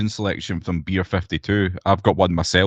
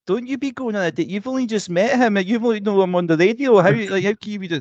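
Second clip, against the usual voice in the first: high, hammed-up disapproving pal; mock meddling friend; 12 semitones higher than usual. Imitating the heroine's friend warning her off a date, high mock voice. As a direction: squeal the warning like a nosy best friend. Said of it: "like, always like".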